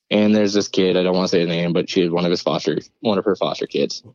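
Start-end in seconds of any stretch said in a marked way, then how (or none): none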